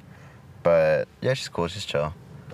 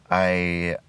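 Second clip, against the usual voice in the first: ordinary talk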